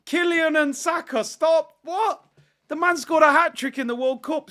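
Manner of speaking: high, loud disbelief at the comparison; exasperated high rant. Real, not acted. Streamer ranting to chat, high and exasperated. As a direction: throw your hands up, voice climbing.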